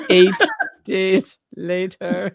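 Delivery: drawn-out slow delivery; slow dramatic reading. Pretend. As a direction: stretch each word out slowly.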